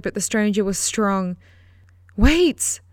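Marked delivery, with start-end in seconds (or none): 2.2-2.8 high, loud frantic cry; woman yelling at a fleeing girl; frantic shout. Acted. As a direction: shout to stop her running off. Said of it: "the voice almost as frantic".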